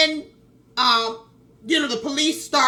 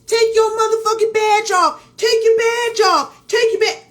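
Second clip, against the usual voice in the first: high, loud mock shout; repeated mock heckle, pitched way up; heckling bystanders; 7 semitones higher, 4 dB louder than usual. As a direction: imitate dudes hollering from the background. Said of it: "you hear some dudes in the background saying".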